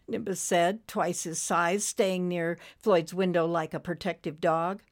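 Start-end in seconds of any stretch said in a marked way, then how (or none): none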